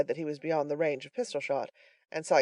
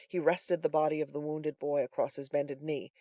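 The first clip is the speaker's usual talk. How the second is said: ordinary delivery, nothing marked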